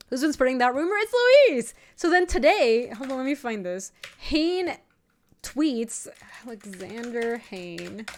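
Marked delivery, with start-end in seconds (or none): none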